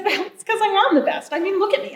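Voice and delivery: a hint of a vain show-off; boastful raven. A narrator's reading. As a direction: boast as if it's obvious.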